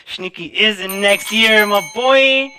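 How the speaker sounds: loud, slow, climbing boast; triumphant hype. Real, not acted. Host topping people who claim next year, triumphantly hyped. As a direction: build to a high, stretched-out victory call.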